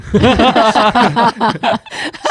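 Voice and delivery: high burst of laughter; laughing hard at himself; loud laugh. Real, not acted. Laughs at own joke. (哈哈大笑)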